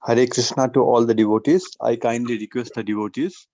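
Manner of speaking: normal talking voice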